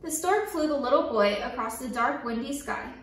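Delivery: ordinary delivery, nothing marked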